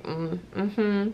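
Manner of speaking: sassy little hum, softly voiced. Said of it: "Look how sassy".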